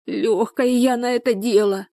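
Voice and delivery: high, tearful lament; hysterical. A narrator's reading. Людмила despairs at falling pregnant so easily, hysterical. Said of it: "билась в истерике Людмила".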